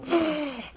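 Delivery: a sharp high gasp like a singer catching breath; imitated singer gasp. To his cohost, mimicking a singer's breath, high sharp gasp. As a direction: copy the singer's breath off the mic. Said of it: "the fucking singer going".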